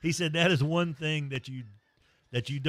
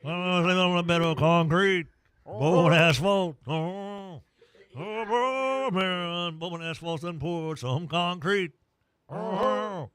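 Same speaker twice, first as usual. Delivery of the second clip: high, loud blustery Southern rooster; hammed-up cartoon rooster pitching asphalt; 5 semitones higher, 7 dB louder than usual. Foghorn Leghorn voice in live ad.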